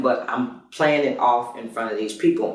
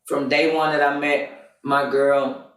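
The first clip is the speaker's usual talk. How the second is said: normal talking voice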